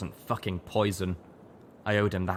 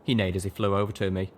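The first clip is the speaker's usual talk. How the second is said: plain reading voice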